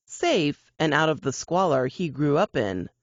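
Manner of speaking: usual speaking voice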